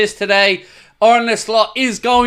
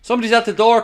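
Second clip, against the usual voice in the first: ordinary delivery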